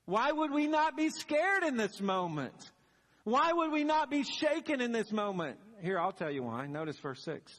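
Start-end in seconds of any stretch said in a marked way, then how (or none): 0.1-5.6 high, louder, pleading disbelief; incredulous protest. Pretend. Women objecting to the angel, high and incredulous. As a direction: throw the question back at heaven.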